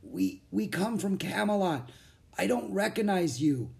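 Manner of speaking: high, halting weak voice; strained, weary knight; dazed knight. A narrator's reading. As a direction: falter as if just waking from a spell. Dazed knight just rescued, still whispering.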